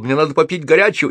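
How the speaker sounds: high, quick, aggrieved demand; indignant. A narrator's reading. Soaked wizard demands a hot drink, indignant. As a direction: rush out the complaint. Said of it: "возмутился Холл".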